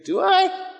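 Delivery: sudden high-pitched self-questioning; doubting question. Acted. Preacher doubting his own sung promise, incredulous.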